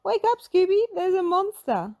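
high, loud panicked call; Shaggy yelling. A narrator's reading. Shaggy waking Scooby in alarm, loud and high. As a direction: shake your buddy awake. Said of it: "Shaggy said".

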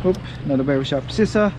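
ordinary talk

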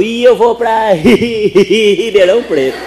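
slow, loud, high drawn-out stutterer; stuttering woman. Acted. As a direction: stretch every word like a stutterer. Gaguinha anuncia viagem. (gaguinha arrastada, alta e aguda)

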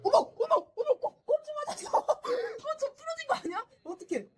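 high, startled exclamations; shocked gasps. Real, not acted. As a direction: gasp in alarm at the hit. Player hit, shocked reaction. (깜짝 놀란 비명)

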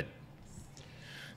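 ordinary talk

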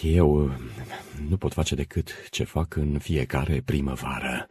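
halting, subdued, nervous answer; trembling voice. Acted. Administrator on the balcony to the silent crowd, trembling. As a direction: falter softly before a silent crowd. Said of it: "cu vocea tremurândă".

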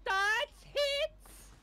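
high incredulous question; can't believe it hit. Real, not acted. Disbelief at game hit.

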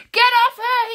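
very high, loud desperate shout; cry out. A narrator's reading. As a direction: yell to drive the witch off. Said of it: "he cried".

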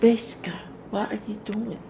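high sing-song call to the puppy; high-pitched pet voice; high dog-talk voice. Real, not acted. Calling the dog.

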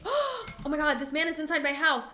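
high, loud gasping panic; gasp, then cry out in alarm; jump-scare shock. Real, not acted. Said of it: "That was a jump scare".

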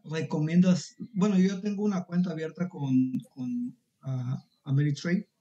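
ordinary talk, nothing marked

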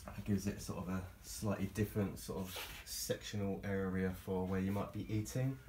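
ordinary talk

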